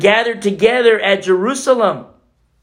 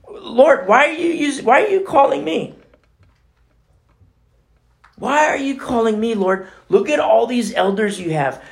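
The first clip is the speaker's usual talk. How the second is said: high, pleading protest to God; high-pitched bewildered prophet; Ezekiel pleading; 6 semitones higher than usual. Retold or imitated. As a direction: plead with the Lord, voice raised high.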